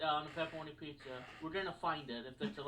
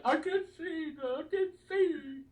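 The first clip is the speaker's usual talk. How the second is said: high, slow wailing cry; crying character voice; 12 semitones higher, 7 dB louder, slower than usual. Retold or imitated. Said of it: "now he's like".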